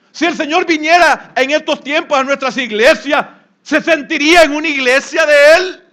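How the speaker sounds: loud, high sermon building toward a climax; preaching at full force. Acted. Pastor thundering at his congregation about leaving the star. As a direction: drive the point home with rising fire.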